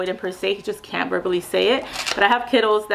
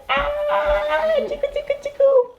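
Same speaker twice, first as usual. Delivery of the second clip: much higher sing-song calls; slower than usual. Real, not acted. Mom playing with her child.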